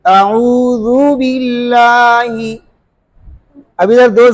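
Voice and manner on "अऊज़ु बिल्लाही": very slow, drawn-out अऊज़ु बिल्लाही; slow Quranic recitation; recitation demo. Shown as a demonstration. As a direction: stretch बिल्लाही so each vowel stands apart. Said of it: "थोड़ा सा खींच लेना है".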